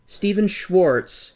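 very slow, deliberate "Stephen Schwartz"; careful slow. Shown as a demonstration. Partner getting composers straight, slow careful naming. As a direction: draw the name out while thinking. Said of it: "I'm gonna do my best".

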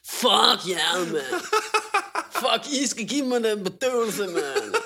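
put-on loud, drawn-out drunken cursing, laughing; imitating drunk defiance. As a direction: play your drunk self swearing at nurses. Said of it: "rækker fuck til de her sygeplejersker".

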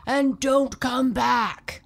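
slow loud yell after him; angry squid yelling at the fleeing dolphin. A narrator's reading. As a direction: shout him off your garden, slow. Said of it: "yelled the giant squid".

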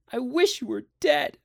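high-pitched bitter outburst; childish anger. A narrator's reading. Child turning on his father, angry.

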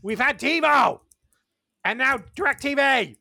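loudly correcting, exasperated; exasperated retort. Retold or imitated. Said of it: "I'm like".

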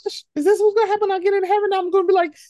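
put-on a small kid frightened about heaven; kid's fear. Child watching All Dogs Go to Heaven, high anxious worry. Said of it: "Or just like".

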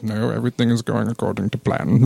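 low, calm, matter-of-fact villain; a light take on a suave villain; Alan Rickman impression. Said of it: "our terrible Alan Rickman impressions".

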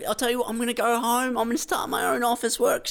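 high, rising to a shout, indignant rant; an irate print customer storming off in mock fury; defiant customer. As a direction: storm off vowing revenge. Playing fed-up Officeworks customer.

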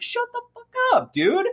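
high, incredulous shut up; exasperated retort. Retold or imitated. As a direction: snap it back in disbelief. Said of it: "And it's like".

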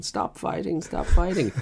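hammed-up flustered husband; imitating her husband. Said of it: "her husband going".

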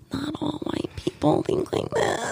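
high, hushed, slowly stretched words; mock muffled aside. Student wanting to answer an uncomfortable classmate, mock hushed protest. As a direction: squeeze it out slowly under your breath. Wished-for reply acted under breath.

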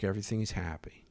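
wry, soft whisper; whispered aside. Real, not acted. Teller whispering that all is fine in a wry aside. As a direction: breathe it out as an ironic aside. Ironic aside after tense standoff.

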